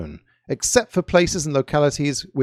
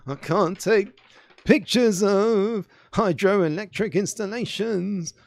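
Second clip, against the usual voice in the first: high, slow, drawn-out rock star voice; put-on high pop-star drawl; 6 semitones higher, slower than usual. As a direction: attempt a famous singer's speaking voice, high and stretched out. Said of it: "I can't do a [name] speaking impression".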